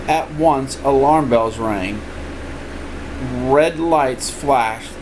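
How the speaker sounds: ordinary delivery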